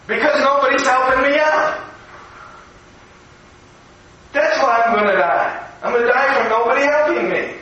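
lightly voiced little boy, plaintive; helpless child. Little boy answers the big boys, mildly plaintive.

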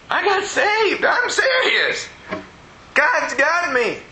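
very high, drawn-out exclamation; fervent insistence. Retold or imitated. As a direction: retell an emphatic declaration at the top of the range. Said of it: "You're serious, aren't you?".

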